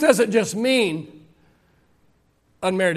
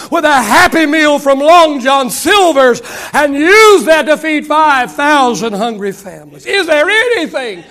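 rising, louder preacher's cry; preaching at full force; 5 semitones higher, 7 dB louder than usual. Acted. Preacher rousing the congregation, full preaching force. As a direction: build it up like a revival preacher.

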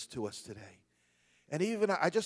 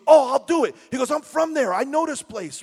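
put-on Brooklyn accent; 11 semitones higher, 12 dB louder than usual. Retold Brooklyn guy, excited.

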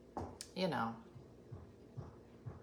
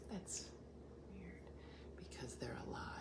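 hushed, softer whisper; whispered protest; 20 dB quieter than usual. Retold or imitated. Dina whispering back that the plants are alive.

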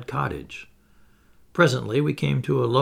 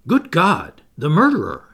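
higher, louder choked cry of horror; 4 semitones higher, 7 dB louder than usual. Acted. Terrified Jopley recognising the wanted man, choking. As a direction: choke out the words in terror. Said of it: "he choked".

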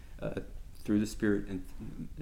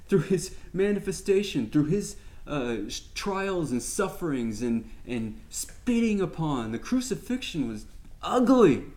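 fervent preaching; 5 semitones higher than usual. Acted. Teacher preaching Christ's sacrifice to his class, fervent and high. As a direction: preach it with rising passion.

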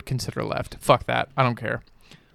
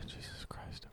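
voiceless whisper under the breath; whispered exasperation; 26 dB quieter than usual. Real, not acted. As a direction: whisper it after a weary sigh. Exasperated aside after a sigh.

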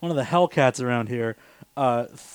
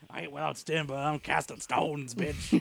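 cat voice; 11 dB quieter than usual. Acted. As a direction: purr it out like a sneaky cat. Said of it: "This is my cat voice".

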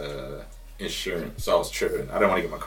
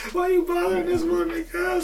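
much higher, put-on voice of a buddy asking why; high mock voice. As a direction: squeak it out like a startled friend. Friend voiced asking about cop.

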